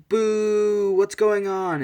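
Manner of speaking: loud, high drawn-out boo; jeering crowd. Retold or imitated. The audience all booing the fight, jeering loudly. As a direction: heckle like a displeased crowd.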